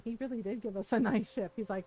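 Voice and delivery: ordinary delivery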